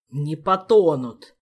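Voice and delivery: loud, slow, booming retort; angry thunder. A narrator's reading. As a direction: thunder it back angrily. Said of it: "гневно прогремел".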